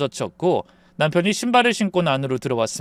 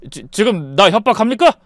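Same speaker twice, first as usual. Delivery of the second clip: stammering start, building louder; panicked shouting; 5 semitones higher, 6 dB louder than usual. A narrator's reading. Husband threatening to call police on the mother, panicked shout. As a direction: protest in alarm, voice raised. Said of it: "하얗게 질린 상태로 소리쳤죠".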